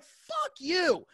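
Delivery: high-pitched indignant rant; retold angry rant. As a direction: act out telling off a neighbor. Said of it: "I just go on this rant".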